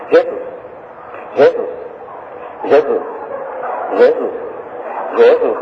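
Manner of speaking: low, slow murmured Jesus; mocking empty repetition; droning repeated Jesus. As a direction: sigh out the name again and again.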